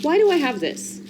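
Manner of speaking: high, baffled question to himself; incredulous. Real, not acted. Vlogger holding up a useless old gift, baffled and high. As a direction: ask it like you can't believe you kept it.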